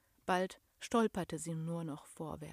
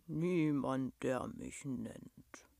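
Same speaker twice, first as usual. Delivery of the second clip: low, slow voice sinking away; sad monster; 6 semitones lower, 4 dB quieter, much slower than usual. A narrator's reading. Lonely creature explains it has no name, sad deep voice. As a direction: answer sadly from deep down, fading. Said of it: "antwortete das Wesen traurig".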